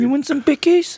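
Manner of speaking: high cutesy offer of a treat; dog-talk voice. Pretend. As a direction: tempt the dog in baby talk. Baby-talking to the dog about biscuits.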